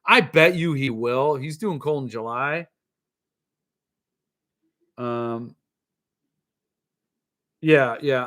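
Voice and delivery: ordinary delivery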